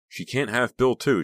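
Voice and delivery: high shrieking cry against the wind. A narrator's reading. Becca shrieking against the wind at her rival. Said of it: "she shrieked".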